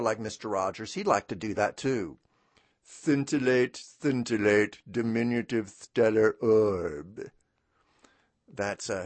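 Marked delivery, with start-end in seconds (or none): 2.8-7.3 slow, grand royal pronouncement; pompous puppet king, drawn out; King Friday voice. Retold or imitated. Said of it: "his King Friday".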